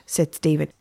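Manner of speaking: usual speaking voice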